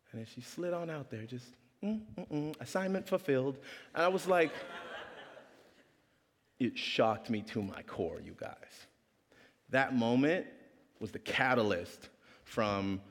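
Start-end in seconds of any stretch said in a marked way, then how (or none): none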